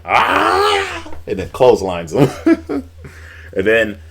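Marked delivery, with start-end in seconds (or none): 0.0-1.1 sudden high, loud mock war cry; imitated yell. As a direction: burst out with a wrestler's yell. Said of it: "he yells like".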